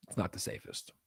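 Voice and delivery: whispered aside, "not" leaned on. Shown as a demonstration. Streamer confiding to chat about Mexico, whispered aside. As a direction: drop to a secretive whisper.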